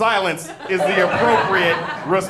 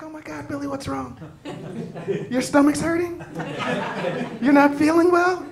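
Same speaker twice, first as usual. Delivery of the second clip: much higher, coddling fuss; a hammed-up fussing mother; doting mom; 10 semitones higher than usual. Rich mother to her sick son, much higher cooing.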